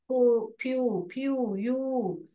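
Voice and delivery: फ्यू and यू drawn out slowly, one by one; slow syllable split. Shown as a demonstration. Teacher splits the syllables for the class, slowly. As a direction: slowly stretch फ्यू, then यू, as separate parts. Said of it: "यहाँ पर divide होता है".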